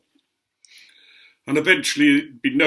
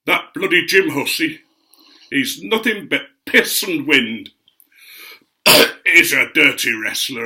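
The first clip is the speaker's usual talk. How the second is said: louder, indignant mum running him down; scornful gossip; 6 dB louder than usual. Retold or imitated. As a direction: spit out the contempt. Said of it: "getting quite derogatory about him".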